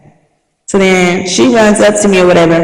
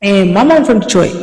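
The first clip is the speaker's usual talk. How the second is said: normal talking voice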